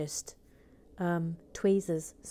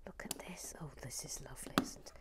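whisper; 4 semitones lower, 11 dB quieter, faster than usual. Real, not acted. Whispering over the build.

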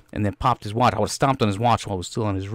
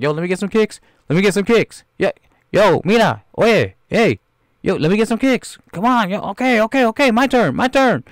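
high, loud calls to be let in; eager joining in; 9 semitones higher, 5 dB louder than usual. Acted. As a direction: clamour excitedly for a turn. Acts Latinos eager to join in.

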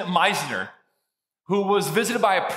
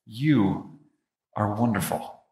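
much lower, faint and drawn out; dying whisper; 7 semitones lower, slower than usual. Retold or imitated. Conan Doyle to his wife with his last breath, faint. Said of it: "he said this to his wife".

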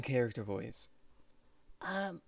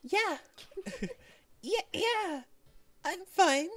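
put-on high, shaky girl insisting she's fine; slower than usual. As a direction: fake being fine with a trembling voice. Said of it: "Her voice shook".

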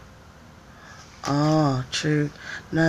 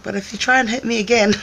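teasing warning; 6 semitones higher, 4 dB louder, faster than usual. Retold or imitated. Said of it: "And I was like".

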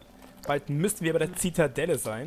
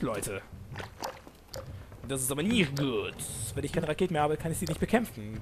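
usual speaking voice

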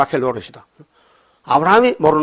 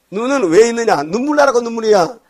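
high exhortation; 7 semitones higher than usual. Real, not acted. Preacher exhorts congregation.

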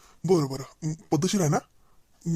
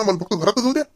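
ordinary talk, nothing marked

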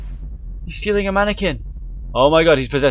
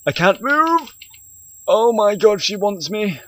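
high, frantic panic; trapped and terrified; panicked cry; 9 semitones higher, slower than usual. Real, not acted. Ghost grabbing him in game.

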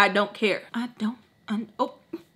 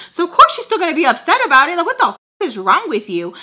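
high, loud, fast tirade; imitating the coworker chewing them out; heated quoted rant; 6 semitones higher, 5 dB louder, much faster than usual. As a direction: rattle it off, fired up and indignant. Quoting Baltimore's heated rant.

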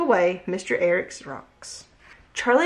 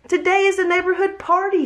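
high, loud eager call; excited shout; 10 semitones higher, 3 dB louder than usual. A narrator's reading. Charlie shouting to Jack and Max about the party. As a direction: shout the news to the neighbors. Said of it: "shouted Charlie".

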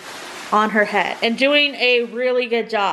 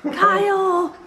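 pitched up, insistent call of Kyle!; second call, pitched up to get his attention; calling out higher; 8 semitones higher than usual. Real, not acted. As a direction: call Kyle! again, higher and more urgent. Calling husband to look.

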